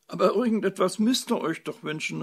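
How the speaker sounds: high, loud plaintive plea; near tears. A narrator's reading. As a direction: beg as if close to tears. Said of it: "rief die Fee den Tränen nahe".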